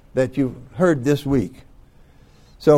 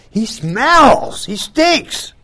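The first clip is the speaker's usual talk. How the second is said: high, loud mock disgust; higher, louder hammed-up protest; Lazarus's sister protesting; 10 semitones higher, 6 dB louder, much slower than usual. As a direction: squeal it up as the sister recoiling from the smell. Sister protests opening the tomb.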